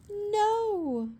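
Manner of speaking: high, loud terrified "No!"; frightened cry. A narrator's reading. Witch crying out as the dragon chases her. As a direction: cry out in fear. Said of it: "cried the witch".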